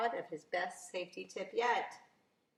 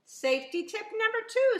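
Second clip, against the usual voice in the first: announcing safety tip; 13 semitones higher, 7 dB louder than usual. Acted. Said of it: "said Officer Buckle".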